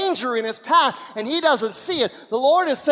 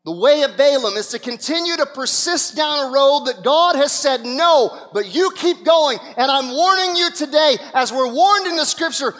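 preaching; 4 semitones higher, 6 dB louder than usual. Acted. Preacher warning the congregation, loud and emphatic. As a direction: hammer home the warning.

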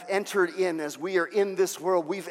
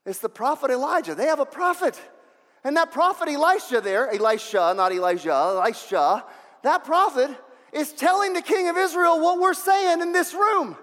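acted urgent report, high and insistent; urgent informant; 8 semitones higher, 4 dB louder than usual. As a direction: build to a high, loud shout at the end. Informant tells king about prophet.

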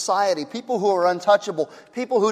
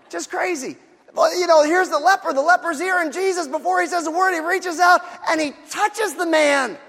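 fervent preaching; 8 semitones higher than usual. Real, not acted. Preacher crying out at Jesus touching the leper, astonished. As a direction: shout the wonder of it.

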